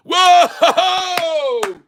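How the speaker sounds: high, loud whoa of triumph; excited whoop. Real, not acted. Trader cheering to viewers as stock breaks out, excited whoop.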